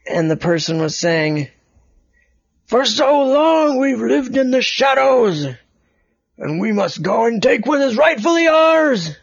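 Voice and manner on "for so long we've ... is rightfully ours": rat leader speech. Acted. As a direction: rouse the crowd from the stage. Rat leader addresses crowd.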